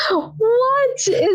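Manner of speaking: very high mock-incredulous squeal; comically aghast, pitched sky-high; exaggerated disbelief. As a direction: play up baffled disbelief way up high. Retelling disbelief at skull remark.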